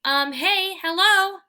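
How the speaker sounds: loud, high call over the fence; loud, high greeting shouted over a fence. A narrator's reading. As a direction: call across to the dogs next door. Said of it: "I call".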